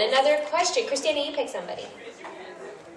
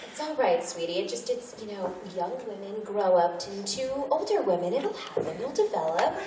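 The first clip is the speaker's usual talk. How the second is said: put-on softer, patronizing older-woman voice; Lychee reassuring; 3 semitones lower, 9 dB quieter than usual. Lychee comforts Kyoko about growing up, softly and patronizingly. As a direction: soothe the worried kid a little condescendingly.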